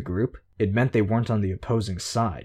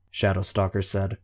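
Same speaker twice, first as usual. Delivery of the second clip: ordinary delivery, nothing marked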